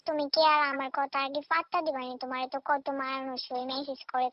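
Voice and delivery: normal talking voice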